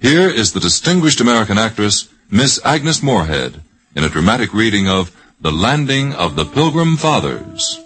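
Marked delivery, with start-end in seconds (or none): none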